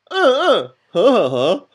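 loud hums over the words; bleeped out. Shown as a demonstration. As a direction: hum loudly to bleep it out. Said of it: "我有一个逼法".

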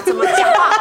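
squeaky incredulous question mocking a friend's speech; quoting a classmate. Classmate asking about a friend's odd speech, mock puzzled high squeak. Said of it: "还问我说".

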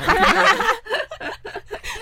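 loud, high bursting laugh; burst of high laughter. Real, not acted. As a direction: break into a high laugh at the memory. Laughing at the memory. (回想起来忍不住高声大笑)

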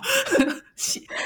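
sudden high-pitched burst of laughter. Real, not acted. Telling a coworker about eating egg-laden fish, high laugh. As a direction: cracks up at her own joke.

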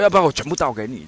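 rattled off fast; 连珠炮似地快速说; fast talking. Retold or imitated. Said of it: "因为他讲话很快". (模仿老板讲话很快)

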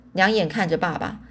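ordinary delivery, nothing marked

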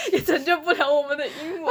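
words broken up by laughter; laughing. Real, not acted. Joking to her co-host about their bad English, laughing. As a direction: laugh it out at yourself.

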